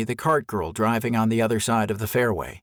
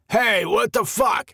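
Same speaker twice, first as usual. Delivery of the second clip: higher, slow, louder angry yell from inside a tent; startled yell; 10 semitones higher, 3 dB louder than usual. A narrator's reading. Camper yelling at an intruder, a startled angry yell. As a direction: bark it out, woken and annoyed. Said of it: "a gruff male voice yelled".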